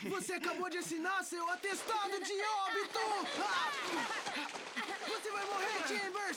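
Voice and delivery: threatening shout. Acted. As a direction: yell it like a war cry.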